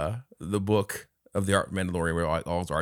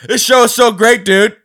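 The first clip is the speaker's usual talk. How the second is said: high, loud gushing about the show; mock enthusiasm; 12 semitones higher, 13 dB louder than usual. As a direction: gush like a friend glued to the TV. Imitates Rob watching show.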